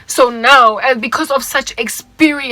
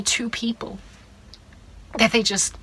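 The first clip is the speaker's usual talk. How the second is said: ordinary talk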